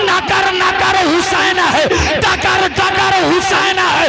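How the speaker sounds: loud, high, ringing sermon climax; fiery preaching. Acted. Preacher roaring to the gathering, loud and high. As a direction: preach it out to the crowd at full force.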